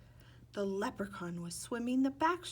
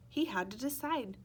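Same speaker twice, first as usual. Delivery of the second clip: ordinary delivery, nothing marked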